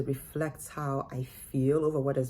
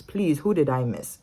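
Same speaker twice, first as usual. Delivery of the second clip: ordinary delivery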